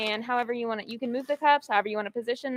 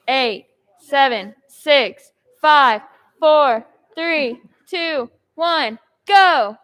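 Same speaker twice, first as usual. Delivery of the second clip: slow, loud count to go; loud countdown; 9 dB louder, much slower than usual. Real, not acted. Host counting down the round, slow and loud. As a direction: call the countdown out to the room.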